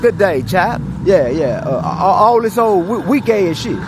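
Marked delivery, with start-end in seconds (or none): 0.0-0.8 lightly put-on British accent, clipped toff phrases; a hint of a posh British accent; mock British accent. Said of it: "back in their regular accent".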